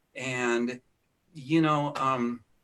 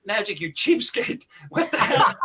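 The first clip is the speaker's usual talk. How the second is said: higher and louder, laughing mock indignation; mock outrage; 6 semitones higher, 5 dB louder than usual. Host mock-scolds Magic for underpaying, laughing, higher and louder. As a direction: scold him in jest, cracking up. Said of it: "I'm listening to this and going".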